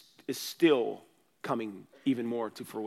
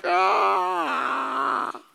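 sudden high shouted cry of emotion; overwhelmed cry; 13 semitones higher, 11 dB louder than usual. Retold or imitated. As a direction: let out a loud, high cry of release. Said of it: "I'm like".

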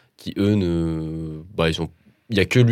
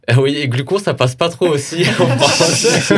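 laughing it off; giggly, loud and amused; 9 semitones higher, 7 dB louder than usual. Real, not acted.

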